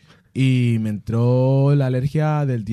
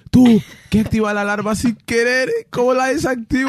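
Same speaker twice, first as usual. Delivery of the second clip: panicked call, louder and higher; urgent calling; 12 semitones higher, 7 dB louder than usual. Retold or imitated. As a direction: plead for help loudly and high. (súplica urgente, más alta y aguda)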